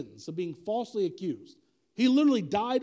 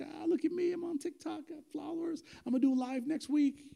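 high, soft, show-off influencer voice; mock influencer; 7 semitones higher, 4 dB quieter than usual. [name] bragging to his TikTok followers, mock influencer. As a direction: parody a self-admiring livestreamer, pitched up high. Mocking imagined social-media success.

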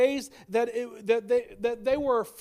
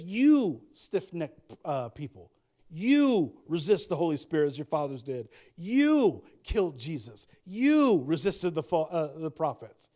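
You drawn out long and loud; stress on You; 4 semitones lower, 3 dB louder, slower than usual. Retold or imitated. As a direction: lean hard and slow on every "You". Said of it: "He changes the pronouns from they, them to you".

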